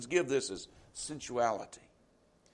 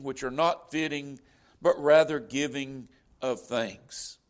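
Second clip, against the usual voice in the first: scripture reading; 3 semitones lower, 5 dB louder than usual. Acted. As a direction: read it out like holy writ to the church. Reads scripture aloud.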